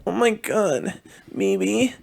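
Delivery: high upspeak Valley Girl impression. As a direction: sing it up high, ditzy. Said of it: "she's got that Valley Girl talk to her".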